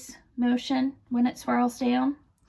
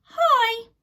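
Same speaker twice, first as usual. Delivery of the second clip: put-on girl's voice; 13 semitones higher, 4 dB louder than usual. A narrator's reading. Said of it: "and she says".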